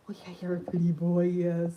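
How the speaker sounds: sweet-talking the parrot on her arm. Real, not acted. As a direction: coo gently at the bird.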